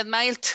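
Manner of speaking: "smiled" ending in a hard T, smailt; second-column T ending. Shown as a demonstration. Teacher ruling out second column, "smiled" said as smailt. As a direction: swap the final D of "smiled" for a crisp T. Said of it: "si fuera en la segunda diría".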